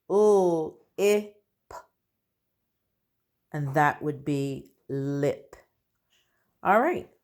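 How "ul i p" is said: each letter sound said separately, with pauses between. Shown as a demonstration. Teacher to pupils, sounding out a word letter by letter. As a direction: split the word into its single sounds for the class. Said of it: "my last word is".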